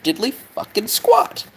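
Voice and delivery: high, slow put-on voice; imitated outburst. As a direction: stretch it out in mock exasperation. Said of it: "where he's like".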